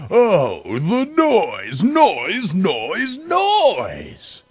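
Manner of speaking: from pained groan to high frantic cry; building exasperation. Acted. Grinch hating the Whos' noise.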